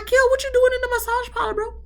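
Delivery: high, fast squeak of disbelief; incredulous high voice. Retold or imitated. Recapper quizzing Kill in high, fast disbelief.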